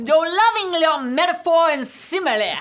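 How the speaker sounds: loud, high Southern holler; big hammed-up good old Southern boy; James Carville impression. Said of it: "so good old Southern boy".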